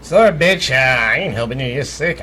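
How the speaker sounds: mock a heartless jailer brushing off a sick prisoner; callous guard voice.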